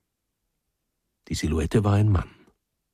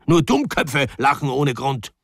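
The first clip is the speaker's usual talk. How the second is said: higher-pitched, irritated rebuke; angry snapping; 5 semitones higher, 3 dB louder than usual. A narrator's reading. Husband rebukes his wife for laughing, angry snapping. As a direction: scold with some heat. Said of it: "fuhr Pawel Iwanitsch seine Frau an".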